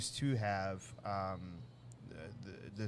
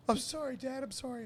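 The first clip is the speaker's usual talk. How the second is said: put-on much higher, small boy's voice; scared kid apologizing; 14 semitones higher than usual. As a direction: plead like a frightened child. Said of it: "he goes".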